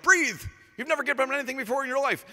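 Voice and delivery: hammed-up shouted panic, high and fast; mock frantic CPR. Preacher mocking a frantic paramedic, shouting high and fast. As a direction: yell at her like a frantic medic.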